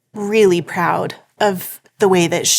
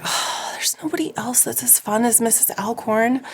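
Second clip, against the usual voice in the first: put-on breathy whisper of disappointed kids; classmates whining; 4 semitones higher, 6 dB quieter than usual. Schoolkids missing their fun classroom helper, whispered. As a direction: let the kids' letdown come out as a whisper. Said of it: "they'd be like".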